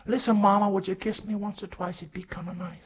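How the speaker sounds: put-on high little-boy voice; high boy voice pleading with mama; pleading child. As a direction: ask mama like a small boy.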